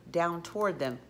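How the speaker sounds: plain reading voice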